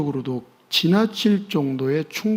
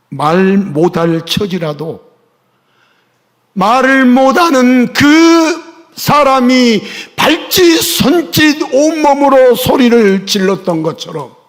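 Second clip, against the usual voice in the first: louder and higher, slow and fervent; impassioned preaching; 10 semitones higher, 12 dB louder, slower than usual. Acted. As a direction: preach it out to the whole church. Preaching at full force. (열정적으로 크게 외치는 설교)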